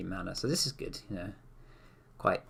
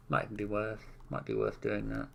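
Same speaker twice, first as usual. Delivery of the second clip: hand over mouth; 6 dB quieter than usual. Real, not acted. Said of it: "I've got my hand over my mouth".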